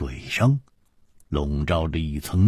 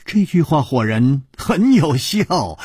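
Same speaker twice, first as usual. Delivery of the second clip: usual speaking voice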